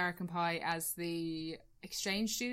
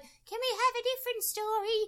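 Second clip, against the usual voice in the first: niece voice; 14 semitones higher, 3 dB louder than usual. Retold or imitated.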